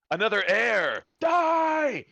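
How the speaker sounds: high, loud hammed-up shout; mock battle cry. Dungeon Master jokes about killing off a new heir, hammed-up shout.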